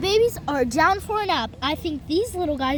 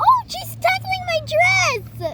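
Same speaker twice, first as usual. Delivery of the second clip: cooing; 9 semitones higher, much slower than usual. Pretend. Cooing over the baby dolls.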